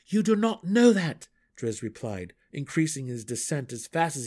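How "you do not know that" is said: high, loud insistence; urgent protest. A narrator's reading. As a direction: argue him down fast. Drow arguing across the cavern.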